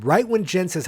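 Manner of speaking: usual speaking voice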